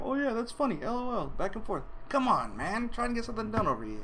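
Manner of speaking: high, exasperated rant; mock irritated ranting; annoyed. Retold annoyance at group texts.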